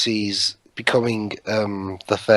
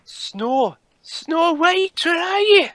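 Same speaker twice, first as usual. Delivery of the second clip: put-on Scottish accent; much slower than usual. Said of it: "I'm trying to do a Scottish accent".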